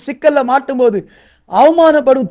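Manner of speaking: usual speaking voice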